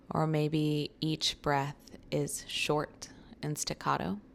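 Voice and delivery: slow, calm meditation voice with long pauses; guided meditation. Real, not acted. Guiding listeners through a short breathing meditation, slow and calm. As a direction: ease the listener into noticing their breath, unhurried.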